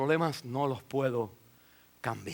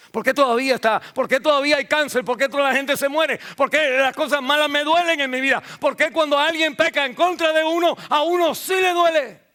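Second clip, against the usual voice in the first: louder, higher questions building to a shout; preaching at full force; 10 semitones higher, 7 dB louder than usual. Acted. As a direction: hammer each why harder and harder. Preacher's rising rhetorical questions. (predicando con fuerza, subiendo hasta gritar)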